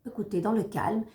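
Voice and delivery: normal talking voice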